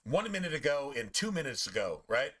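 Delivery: usual speaking voice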